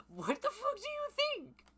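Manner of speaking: very high, loud put-on squawk; exasperated shriek. Acted exasperated retort in story.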